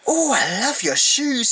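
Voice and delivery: high, slow gushing mimic of the actress; gushing imitation. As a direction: coo it like a dappy fan of shoes. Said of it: "She was like".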